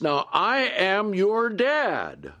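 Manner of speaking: high, slow stern father laying down the law; stern dad. Retold or imitated. Dad to child in real trouble, stern and high. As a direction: talk down to a kid in trouble. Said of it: "and he says".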